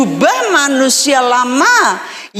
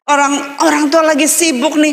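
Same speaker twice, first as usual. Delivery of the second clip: ordinary talk, nothing marked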